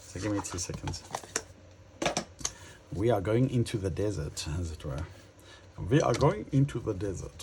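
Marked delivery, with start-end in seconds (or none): 5.9-7.4 higher mock German accent, words clipped hard; self-mocking German accent line; bad Dutch German accent. Shown as a demonstration. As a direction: put on a clumsy Dutch-German accent for fun. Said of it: "that's my really bad Dutch German accent".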